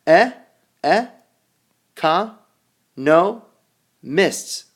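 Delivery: each syllable of "economists" sounded out slowly on its own; spelling out a word by its sounds. Shown as a demonstration. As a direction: break "economists" into E-co-no-mists, pausing between.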